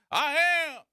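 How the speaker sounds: high, loud mock bird voice with clucks; clucking bird revolutionary. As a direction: crow it out like a chicken declaring revolution. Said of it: "this bird going".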